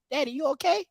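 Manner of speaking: put-on soft, high kid voice; son's voice. Voices his young son.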